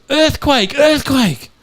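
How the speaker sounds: high-pitched warning cry; mock alarm yell. Redhead yelling to scatter the firing squad, mock alarm. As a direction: call a false alarm up high. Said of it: "she yells".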